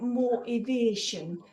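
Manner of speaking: drawn-out Geordie vowels, slow; Geordie accent. Shown as a demonstration. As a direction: stretch it out in broad Geordie. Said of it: "said in a Geordie accent".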